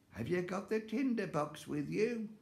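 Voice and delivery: high, scheming witch voice; high cackly old witch. Acted.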